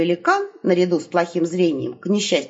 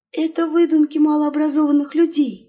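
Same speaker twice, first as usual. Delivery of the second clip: ordinary delivery, nothing marked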